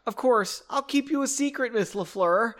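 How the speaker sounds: put-on high-pitched, eager little boy. A narrator's reading. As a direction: pipe up high like a pleased kid. Voices the smiling young boy Byron.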